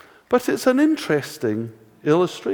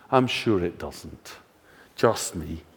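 ironic aside; 7 semitones lower, 5 dB quieter, slower than usual. Acted. Ironic self-deprecating aside.

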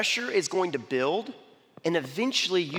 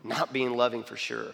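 sincere apology; 7 semitones lower, 5 dB quieter than usual. Retold or imitated. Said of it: "I was like".

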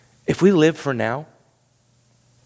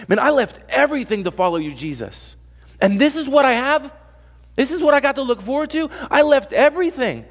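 mock complaint; 10 semitones higher, 4 dB louder than usual. Believer voiced complaining to Jesus.

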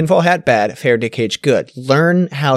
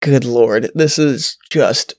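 normal talking voice

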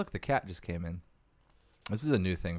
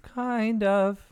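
mock high echo; 8 dB louder than usual. Mocking echo.

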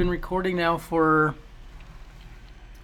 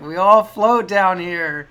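slow, loud creepy clown line; 3 semitones higher, 9 dB louder, much slower than usual. Retold or imitated. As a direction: drawl it out like a horror clown. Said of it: "It's gonna be like".

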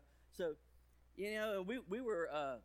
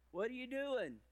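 put-on toddler asking; 9 semitones higher, 4 dB louder than usual. Daughter asking through the door.

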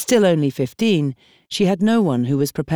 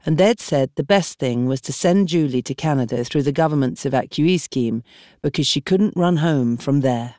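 usual speaking voice

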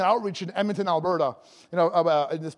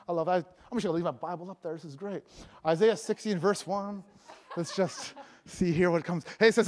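ordinary talk

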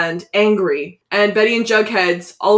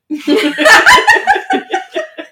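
loud, high-pitched real laugh; burst of laughter; 6 dB louder than usual. Real, not acted. Host cracking up at the iPad joke, loud high laughter. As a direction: crack up loudly at your own joke.

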